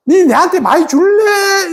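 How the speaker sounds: high, drawn-out mock begging; needy pleading.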